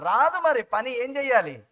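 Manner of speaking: usual speaking voice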